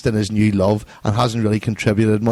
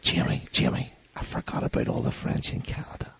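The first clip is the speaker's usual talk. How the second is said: whispering; 5 semitones lower, 11 dB quieter than usual. Real, not acted. Sudden aside about the French.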